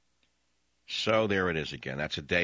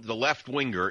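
ordinary talk

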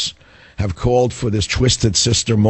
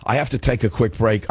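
normal talking voice

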